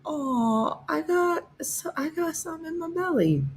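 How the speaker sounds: high, soft whiny dog voice; voicing the sad dog. Owner voicing her dog's sad face, high and whiny. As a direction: whimper it like a pup with a bellyache. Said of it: "This is also her like".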